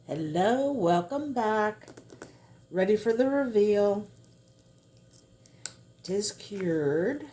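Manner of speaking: bright, eager welcome back; excited. Real, not acted. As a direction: build up to the big reveal. Back for the reveal.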